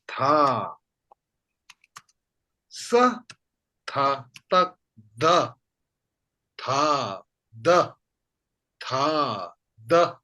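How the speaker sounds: teacher sounds out tha, sa, tak, da one by one; syllable demo. Shown as a demonstration. Tibetan teacher showing students the prefix sound change, careful syllables. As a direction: spell out each syllable clearly for the class. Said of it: "For example".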